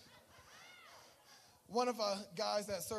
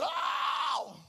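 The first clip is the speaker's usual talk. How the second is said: happy shout; 4 semitones higher, 9 dB louder than usual. Retold or imitated. He got happy in church.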